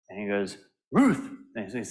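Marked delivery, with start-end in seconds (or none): 0.9-1.3 dog bark word. Retold or imitated. Dog voiced barking answer.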